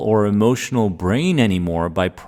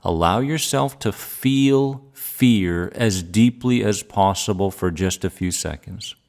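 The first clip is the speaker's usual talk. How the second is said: calm grounding exercise. Acted. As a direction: calmly guide each feeling, pausing long. Guides a feeling exercise.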